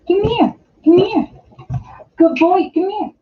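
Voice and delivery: high, eager coaxing call to the puppy; calling the dog. Real, not acted. Owner coaxes puppy to the camera, high pet-talk call.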